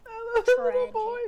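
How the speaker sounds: tickled, high-pitched and breaking up; laughing. Real, not acted. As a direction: squeeze the words out before bursting into laughter. Cracking up at child character.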